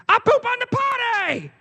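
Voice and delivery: put-on proud two-year-old announcing from the back seat; toddler voice. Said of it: "he's going".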